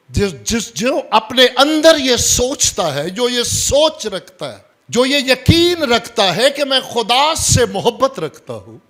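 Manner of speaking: high, loud, driving sermon; full-force preaching. Real, not acted. Preacher describes the believer to congregation, high and forceful.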